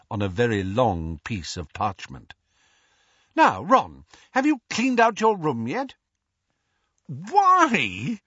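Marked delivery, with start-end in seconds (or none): 3.3-5.9 bustling mum voice, higher and louder, checking up on her son; a busy mother nagging about chores; mother pressing her son about his messy room; brisk mother. Acted. 7.0-8.2 put-on sudden, high, louder teen outburst; exclaiming. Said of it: "exclaimed Ron".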